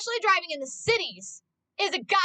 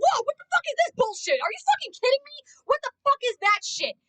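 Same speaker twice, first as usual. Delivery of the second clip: much higher, louder outraged yelling; road rage yell; 11 semitones higher, 3 dB louder than usual. Retold or imitated. Passenger yelling at a bad driver, outraged. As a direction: blow up at another driver. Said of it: "I'm like".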